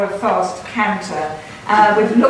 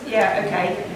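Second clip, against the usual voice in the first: usual speaking voice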